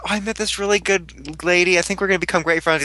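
a light imitation of a cheerful new-friend voice; cheery mock Sarah. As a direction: sound overly pleased about the new friend. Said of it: "Sarah's like".

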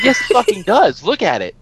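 loud exasperated insistence, jumping high on fucking; retold shout. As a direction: bark it back at a stubborn kid. Argument with seven-year-old.

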